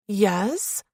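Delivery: controlled, slightly irked answer rising at the end; touch of annoyance. A narrator's reading. Woman answering her interrogator, controlled with slight annoyance. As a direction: answer with control and mild irritation. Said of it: "a bit more control, and a touch of annoyance".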